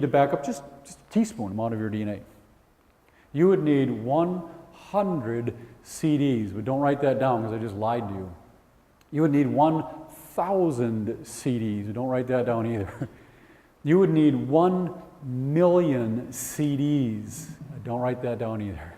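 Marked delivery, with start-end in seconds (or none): 3.3-6.5 "one hundred C Ds" spaced out, slowly; slow dramatic reveal. Real, not acted. Teacher teasing the class with a slow reveal. 9.1-11.9 "one hundred C Ds" spaced out, slowly; slow dramatic reveal. Real, not acted. Teacher teasing the class with a slow reveal. 13.8-17.5 "one hundred C Ds" spaced out, slowly; slow dramatic reveal. Real, not acted. Teacher teasing the class with a slow reveal.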